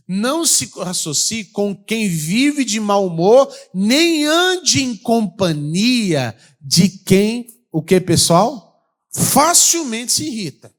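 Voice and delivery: slow, loud scripture reading; preaching scripture. Acted. Pastor proclaims proverb to congregation, slow and loud. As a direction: proclaim the verse slowly.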